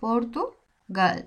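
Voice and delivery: Portugal stretched very slowly, falling; deliberately slow Portugal; slow pronunciation. Shown as a demonstration. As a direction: draw out Portugal slowly for learners. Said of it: "কি বললাম?".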